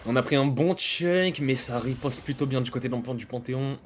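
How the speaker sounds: esports caster commentary; play-by-play. Acted. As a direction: call the match live, steady pace. Casts the match live.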